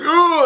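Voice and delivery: high, loud effort groan; straining grunt. Retold or imitated. Stallone going over the top, straining. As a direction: groan as if heaving an arm down.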